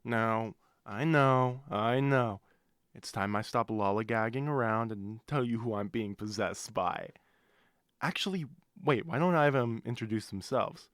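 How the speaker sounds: normal talking voice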